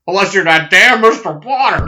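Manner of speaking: high, loud Jimmy Stewart impression; loud, high-pitched old-movie star voice. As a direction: exclaim it like an indignant Jimmy Stewart. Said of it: "Ozzy is basically Jimmy Stewart".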